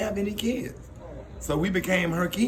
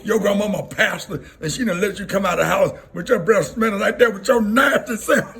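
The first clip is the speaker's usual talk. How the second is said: loud, raised mock telling-off; hammed-up scolding, building louder; scolding teacher; 3 semitones higher, 7 dB louder than usual. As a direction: imitate a teacher roasting a kid. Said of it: "she would go right there".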